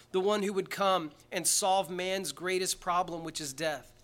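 ordinary talk, nothing marked

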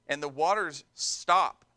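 ordinary talk

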